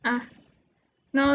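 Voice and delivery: ordinary talk, nothing marked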